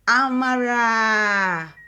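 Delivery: long, loud, stretched-out call; drawn-out announcement. Acted. Daughter announcing her mother to the room, stretched out. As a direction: announce her grandly like a host.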